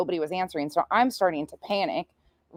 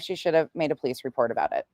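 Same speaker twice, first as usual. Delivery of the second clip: ordinary talk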